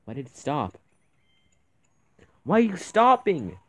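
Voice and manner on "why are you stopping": loud, exasperated yell at the game; frustrated shout. Real, not acted. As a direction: yell it in disbelief. Character stops running in game.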